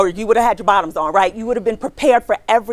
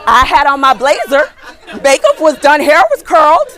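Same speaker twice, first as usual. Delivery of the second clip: loud and high, laughing through it; 7 semitones higher, 12 dB louder than usual. Real, not acted. Recruiter laughing loudly at her own story. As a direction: crack up while listing the outfit. Laughing while recalling dressed-up look.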